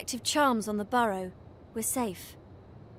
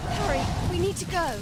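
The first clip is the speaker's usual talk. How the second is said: frightened urging; 6 semitones higher, 3 dB louder than usual. Acted. Fleeing the attack.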